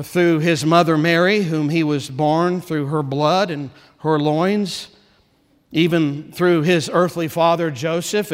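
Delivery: ordinary delivery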